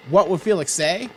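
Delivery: announcer voice. A narrator's reading. Recap outro, announcer read.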